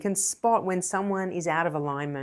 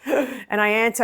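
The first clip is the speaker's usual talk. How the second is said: ordinary talk, nothing marked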